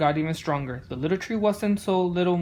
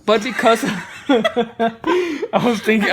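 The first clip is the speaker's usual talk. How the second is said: louder, giggling through the words; laughing at himself; 4 semitones higher, 6 dB louder, slower than usual. Real, not acted. Reader laughing at his own tongue twister, giggly. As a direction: crack up over a fumbled line. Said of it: "pretty great tongue twister".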